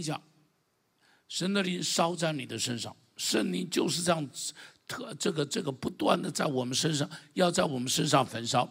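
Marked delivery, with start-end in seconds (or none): none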